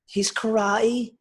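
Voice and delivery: high, slow, mock Liverpool Scouse accent; bad Scouse accent. Darren Till sizing up Wonder Boy, in a mock Scouse accent. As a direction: drag it out in an overdone Scouse accent. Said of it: "Again, in my shit Scouse accent".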